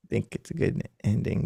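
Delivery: ordinary talk, nothing marked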